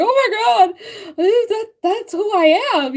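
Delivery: very high, loud and slow awe; high, awed gasp of discovery; amazed realization. Retold or imitated. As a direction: draw it out in stunned wonder. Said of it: "I'm like".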